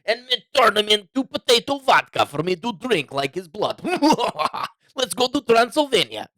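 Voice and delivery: put-on over-the-top Dracula voice in a Russian accent; vampire Putin. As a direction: gloat like a cartoon vampire, laughing. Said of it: "my worst Russian accent".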